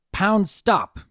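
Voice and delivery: aunt commanding the colt to stop; stern command. Acted. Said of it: "commanded the Pegasus with a stern voice".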